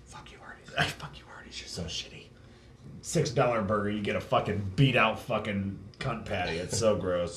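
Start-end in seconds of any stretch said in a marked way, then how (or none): 0.1-2.2 hushed, voiceless swearing; whispered curse. Real, not acted. Cursing the fast food place, whispered. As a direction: hiss it under your breath.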